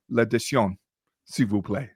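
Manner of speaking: "L'addition, s'il vous plaît" said in French; French phrase. Shown as a demonstration. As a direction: ask for the bill the French way. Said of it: "you would say".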